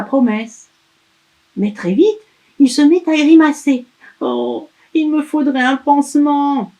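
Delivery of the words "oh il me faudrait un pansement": put-on high, pleading child; whiny elf. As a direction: whine for a bandage. Elf grimacing.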